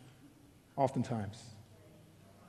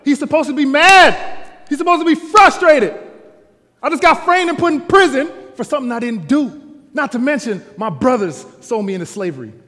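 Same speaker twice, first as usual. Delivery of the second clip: loud, high preacher's climb; preaching at full force; 7 semitones higher, 10 dB louder than usual. Acted. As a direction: drive the point home to the congregation.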